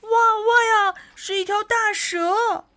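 very high alarmed yelp; frightened cry. Acted. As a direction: cry out in alarm at a sudden danger. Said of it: "小青蛙们都吓坏了".

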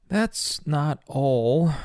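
groaning. A narrator's reading. Father warning the babysitter about his son, groaning. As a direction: groan it out, stretching each word. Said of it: "groaned Paul".

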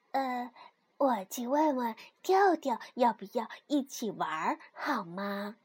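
soft, hesitant low voice; timid. A narrator's reading. 蹦蹦 timidly asks the pushy piglet. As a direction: ask tentatively, hushed.

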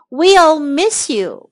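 WE'LL MISS YOU drawn out word by word; slow model phrase. Shown as a demonstration. Teacher models a new phrase for learners, slowly.

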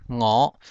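我 as Hokkien "ngôo", starting on ng; initial nasal. Shown as a demonstration. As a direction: say 我 with an ng onset, rising. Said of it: "有个鼻音我".

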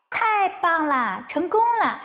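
a touch of cheering rabbit. A narrator's reading. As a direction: celebrate a rescue lightly. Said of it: "小兔子欢呼起来".

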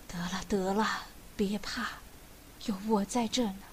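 soft, low, half-whispered reassurance; soothing. A narrator's reading. Old man stroking sobbing daughter-in-law's head, gently reassuring. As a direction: comfort tenderly in a hushed voice.

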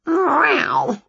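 high complaining meow; a fed-up cat's cry. A narrator's reading. Wilbur protesting at being poked, high meow. Cat cries out.

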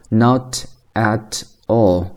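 "not at all" slowly, one word at a time; careful unlinked "not at all"; separate words. Shown as a demonstration. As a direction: leave gaps between each word of "not at all". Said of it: "那它几个单词呢".

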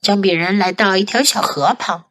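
plain reading voice

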